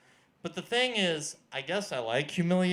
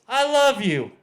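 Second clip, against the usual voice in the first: sudden loud confession; 7 semitones higher, 6 dB louder than usual. Retold or imitated. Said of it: "she said".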